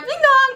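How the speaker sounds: high chiming doorbell; sung doorbell. Acted. Doorbell interrupting the assistant, sung high. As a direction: chime it on high notes.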